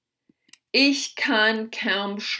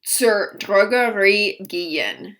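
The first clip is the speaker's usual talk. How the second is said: slow clear model; 3 dB louder than usual. Shown as a demonstration. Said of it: "teacher models German phrase".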